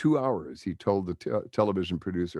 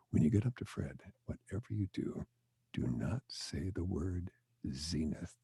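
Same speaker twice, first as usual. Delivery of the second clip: hushed warning; 9 dB quieter than usual. Retold or imitated. Store staff quietly warning fans in line, hushed and secretive. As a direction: lean in and warn them under your breath.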